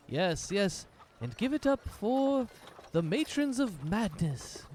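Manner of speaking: high, slow showman's call to the crowd; rally the crowd like a stage host; hyped announcer. Acted. Dancer hyping the crowd after the show, high announcer call.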